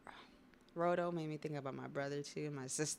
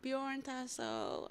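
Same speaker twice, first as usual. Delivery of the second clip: slow, higher called-out name; yelling a name; 7 semitones higher, much slower than usual. Retold or imitated. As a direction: call his brother across the field, drawn out.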